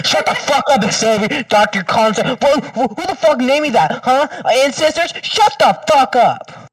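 high, loud yelling at friends; shrill joke rant; mock outburst. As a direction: blow up at them in jest. S1 yelling at friends mid-call.

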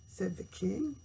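usual speaking voice

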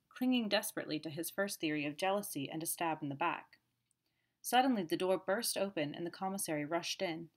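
plain reading voice

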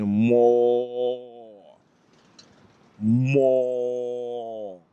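mooing cow. Retold or imitated. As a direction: moo the word long and low. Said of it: "She's saying".

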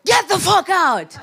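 high, loud, drawn-out exclamation; mock disbelief. Uber driver reacts to passenger's Netflix specials, mock disbelief. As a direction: exclaim it slowly, acting amazed.

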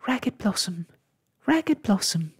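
high-pitched calling voice. A narrator's reading. Cuddlepie calls after departing Ragged Blossom, calling out. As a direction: call the name as if from afar. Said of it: "he called".

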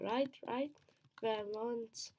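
ordinary delivery

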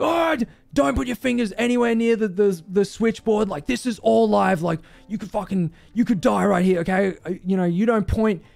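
high-pitched mock angry scolding; losing it. Coworker berating him for pointing at live circuits, losing it. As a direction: imitate a worked-up boss telling someone off. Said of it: "loses it. He's like".

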